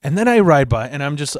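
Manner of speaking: normal talking voice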